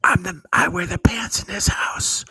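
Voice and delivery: high, strained whispery declaration; mock defiant boast. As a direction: play the boss in a strained, airy shout. Mock boast, wife possibly within earshot.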